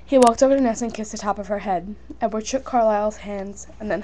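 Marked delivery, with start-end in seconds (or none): none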